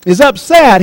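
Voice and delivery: normal talking voice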